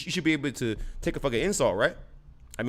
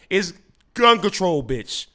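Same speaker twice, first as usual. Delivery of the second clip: ordinary talk, nothing marked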